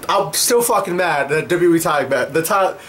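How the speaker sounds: ordinary delivery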